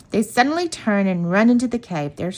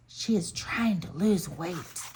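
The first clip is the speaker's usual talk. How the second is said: softer and slower, a secret aside; a cub's slow, soft confiding aside; soft whisper; 7 dB quieter than usual. A narrator's reading. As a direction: lean in and say it softly, slowly. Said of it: "Then he whispers in a soft voice".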